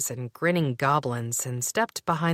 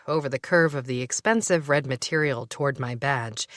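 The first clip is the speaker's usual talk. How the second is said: ordinary delivery, nothing marked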